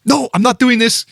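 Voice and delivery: high, loud mock freakout; imitating angry outburst. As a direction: play the furious friend losing it. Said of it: "full spaz mode".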